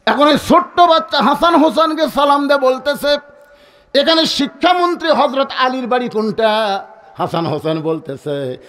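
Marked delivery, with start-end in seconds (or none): 0.1-6.9 high, ringing sermon storytelling; high-pitched preacher's narration; preaching. Acted. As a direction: tell it raised, as to a big crowd. Preacher's raised chanting storytelling.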